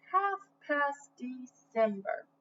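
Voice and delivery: plain reading voice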